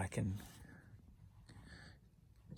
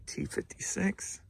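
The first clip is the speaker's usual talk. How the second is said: whisper; 8 semitones higher, 4 dB quieter, slower than usual. Real, not acted. Whispered item code.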